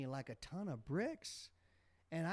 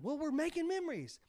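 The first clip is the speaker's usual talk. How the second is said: high, raised retold yell; yelled memories line; 13 semitones higher than usual. Dad retelling how he yelled at the sidelines. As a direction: call it out like the old sideline dad. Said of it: "I always used to say".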